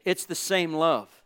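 usual speaking voice

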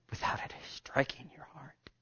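whispered, barely voiced; hushed plea to the heart. Real, not acted. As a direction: breathe it to them. Preacher drops to whisper.

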